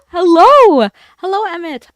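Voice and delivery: big high shouted hello; loud greeting. Real, not acted. Streamer shouts hello to a new viewer, loud and high. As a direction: call out hello with full voice.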